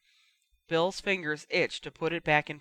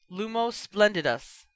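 quick spell; 4 semitones higher than usual. A narrator's reading. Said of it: "Bill said quickly".

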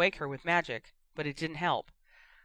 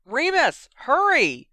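urgent shout; 10 semitones higher, 11 dB louder, slower than usual. A narrator's reading. Calling for help through fireplace.

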